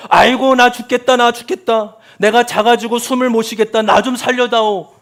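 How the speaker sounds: loud, high wailing cry for help; anguished lament. Acted. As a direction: cry out as if suffocating. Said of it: "탄식하고 외칠 때가".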